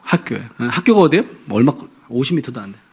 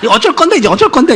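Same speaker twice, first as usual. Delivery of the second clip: much higher, louder exasperated cry; mock panicked berating; 11 semitones higher, 6 dB louder than usual. Wife to husband about the shameful theft, high and loud. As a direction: throw up your hands in alarm.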